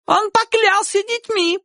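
very high, squealing woman's voice; shrill. A narrator's reading. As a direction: protest shrilly in your defence. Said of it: "визгливо сказала женщина". (визгливый женский голос)

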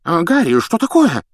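startled, high and loud; alarmed exclamation. A narrator's reading. Minister startled by Harry out of bed, alarmed. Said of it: "встревоженно воскликнул Фадж".

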